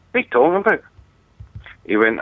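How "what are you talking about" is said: shocked disbelief. Retold or imitated. Shocked grandson retold.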